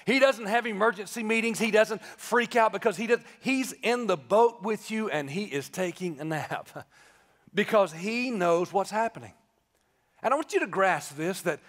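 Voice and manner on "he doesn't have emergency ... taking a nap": ordinary talk, nothing marked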